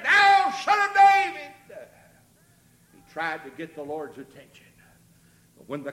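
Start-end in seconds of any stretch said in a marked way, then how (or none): 0.0-1.9 slow, very high drawn-out shout; crying out. Acted. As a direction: bellow it to get his attention. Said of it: "He just cried and said".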